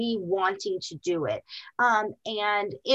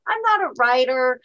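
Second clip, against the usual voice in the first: laughing disbelief; 12 semitones higher, 5 dB louder than usual. Retold or imitated. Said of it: "And I thought".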